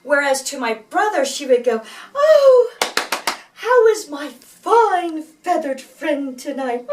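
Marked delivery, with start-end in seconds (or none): 2.1-6.9 much higher, louder, slow gushing coo; gushing piano teacher. Retold or imitated. Teacher gushing over the favourite brother, high and slow. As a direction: croon it like a doting old teacher. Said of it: "like that".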